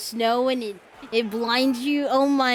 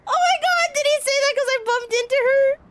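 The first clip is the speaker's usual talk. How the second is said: loud, very high squeal through laughter; laughing squeal; 6 dB louder than usual. Real, not acted. Player bumped into a game character, laughing squeal. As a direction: shriek it out while cracking up.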